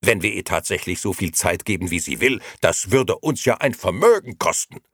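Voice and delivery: loud, high indignant protest; angry outburst. A narrator's reading. The chairman protesting to the gentlemen, loud. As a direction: scold the room about the cost. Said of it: "schrie der Vorsitzende".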